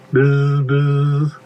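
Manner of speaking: sustained monotone insect buzz; imitated buzzing. Reader imitates insects passing overhead, droning buzz. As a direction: hold the z on one note.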